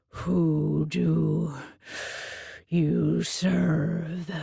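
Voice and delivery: low, heavy beast voice; low Minotaur demand. A narrator's reading. Minotaur asking who she serves, low. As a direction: demand it like a looming monster.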